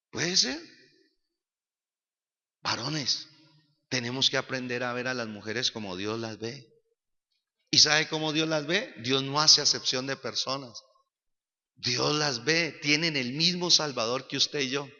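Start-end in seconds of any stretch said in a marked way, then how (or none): none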